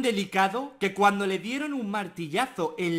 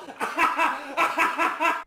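laughing; 6 semitones higher than usual. Real, not acted. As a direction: crack up laughing. Laughs at own joke.

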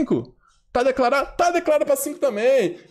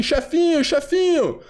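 higher-pitched mock plea to the boss; put-on pleading, pitched up; whiny underlings; 4 semitones higher than usual. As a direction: imitate followers pestering their little boss. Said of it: "Os caras devem tá lá assim".

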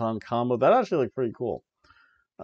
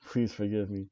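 mock humbled plea; 4 semitones lower, 8 dB quieter than usual. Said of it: "I've been humbled".

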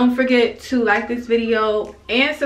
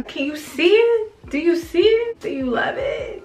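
excited; 6 semitones higher than usual. Real, not acted. Excited showing finished hair.

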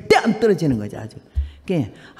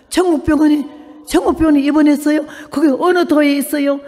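high, loud puzzled questions; nun amazed at the answer, raised voice; astonished nun; 6 semitones higher, 4 dB louder than usual. Retold or imitated. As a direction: repeat it in disbelief. Nun's astonished reply.

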